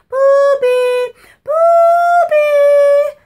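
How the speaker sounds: very high, very loud shriek; screaming. Retold or imitated. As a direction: shriek a warning across the lot. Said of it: "she's continuously yelling".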